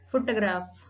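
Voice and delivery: "photograph" with PHO stressed; teacher's model "photograph", primary accent on PHO; accent on first syllable. Shown as a demonstration. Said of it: "Primary accent on the first syllable".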